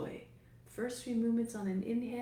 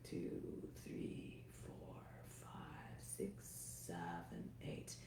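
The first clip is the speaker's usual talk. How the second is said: whispered counting; 14 semitones lower, 11 dB quieter, slower than usual. Acted. Counting breaths during slow exhale.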